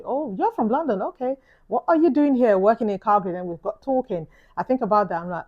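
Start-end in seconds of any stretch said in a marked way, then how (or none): 0.0-3.3 higher and louder, friendly surprise; surprised British stranger at the airport, delighted. Retold or imitated. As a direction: play the delighted stranger hearing a London accent.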